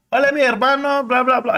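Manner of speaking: put-on high little girl greeting in Spanish; little sister in Spanish. Little sister greeting her brother, high and eager. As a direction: chirp it like an excited little girl. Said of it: "she comes up in all Spanish".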